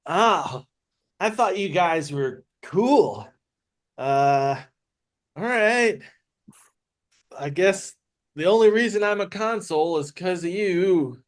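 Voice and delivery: high, drawn-out hurt complaint; mildly hammed-up sad whine; wounded lament. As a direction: play him let down and plaintive. Character laments lost friendship.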